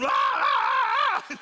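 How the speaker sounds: very high, loud, slow comic scream; a hammed-up panicked shriek. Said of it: "I started going".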